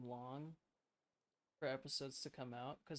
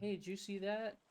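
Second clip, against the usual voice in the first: mock kid voice; 7 semitones higher, 5 dB louder than usual. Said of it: "They were like".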